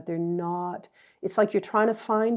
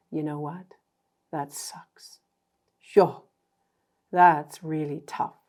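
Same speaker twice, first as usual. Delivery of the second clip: blunt blokey empathy; 3 semitones lower than usual. Retold or imitated. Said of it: "it doesn't have to be emotional".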